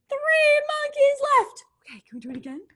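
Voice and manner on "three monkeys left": excited. Pretend. Teacher exclaims count.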